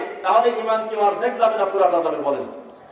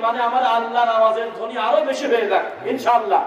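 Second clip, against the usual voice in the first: normal talking voice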